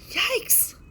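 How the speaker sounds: very high, loud yelp of fright; a startled yelp; scream. A narrator's reading. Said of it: "screamed Cody".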